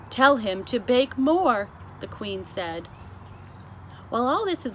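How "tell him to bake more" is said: louder, slower royal order; firm command. Acted. The queen orders the chef, loud and slow. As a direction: lay it down as law.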